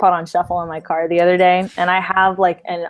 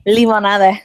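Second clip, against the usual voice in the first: Spanish accent; 7 dB louder than usual. Shown as a demonstration. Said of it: "proper accent".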